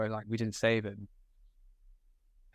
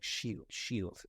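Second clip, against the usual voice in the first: Keaton quote; 3 semitones lower, 8 dB quieter than usual. Retold or imitated. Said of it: "he's like".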